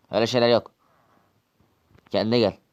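a loud firm scolding; stern shout. Acted. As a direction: shout him back to his senses. Calming the man threatening violence.

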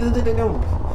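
panicked. Real, not acted. Panic, high.

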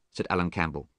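ordinary delivery

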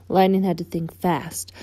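usual speaking voice

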